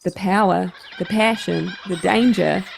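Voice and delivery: put-on dramatic trailer voice in a New Zealand accent; Kiwi accent. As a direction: epic New Zealand accent announcer intro. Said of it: "you wanna do it in a Kiwi accent".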